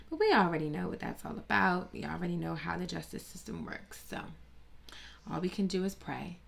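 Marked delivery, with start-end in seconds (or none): none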